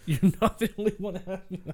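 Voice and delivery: high, laughing imitation of the reply; laughing mock echo. Co-host teasing friend with the man's forgiving reply, laughing mockery. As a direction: repeat it mockingly through a high laugh.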